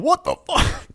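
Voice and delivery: high, startled curse; player's outburst. Retold or imitated. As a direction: yelp it like a shocked player on the mic. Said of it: "picked up a player going".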